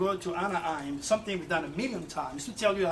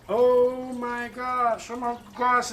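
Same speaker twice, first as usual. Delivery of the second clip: slow, louder stunned exclamation; dawning shock, drawn out and louder; shocked realization; 5 semitones higher, 6 dB louder, slower than usual. Real, not acted. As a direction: let the dread sink in slowly. Realizes lost time again.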